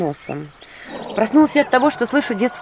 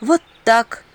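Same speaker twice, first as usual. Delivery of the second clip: plain reading voice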